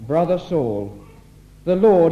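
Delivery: ordinary talk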